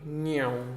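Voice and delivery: a short vocal "Meow" that bends in pitch; whizzing-past sound effect. Shown as a demonstration. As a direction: demo the Doppler whoosh with the voice. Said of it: "What else is it doing?".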